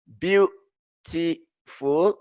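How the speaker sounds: "beautiful" slowly, in three separate beats; split into syllables. Shown as a demonstration. Teacher contrasting beautiful with one-syllable long, slowly split into syllables. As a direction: pause after each syllable of "beautiful". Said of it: "مقطع ثلاثة".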